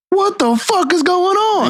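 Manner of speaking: walk in on a hallway of revolvers, stunned; shocked disbelief. Retold or imitated. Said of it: "I'm like".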